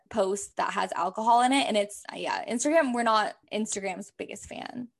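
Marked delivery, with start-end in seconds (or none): none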